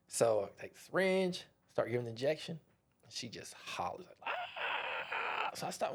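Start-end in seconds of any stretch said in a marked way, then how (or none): 4.3-5.5 mock holler. Patient hollers at injection.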